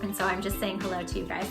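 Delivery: ordinary delivery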